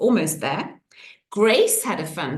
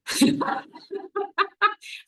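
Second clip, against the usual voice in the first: surprised laugh; 12 semitones higher than usual. Real, not acted. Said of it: "laughing at dog surprise".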